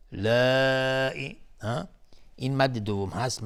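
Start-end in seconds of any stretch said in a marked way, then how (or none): 0.1-1.4 لائی with a long-held aa; obligatory madd. Shown as a demonstration. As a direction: stretch لا slowly, then a short ئی. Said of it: "مد واجب متصل".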